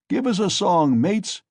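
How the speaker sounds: high, loud rowdy call; shouted. A narrator's reading. Someone in the cart calling his mates to sing, loud high shout. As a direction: rouse the drunk crowd to sing. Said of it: "shouted someone in the cart".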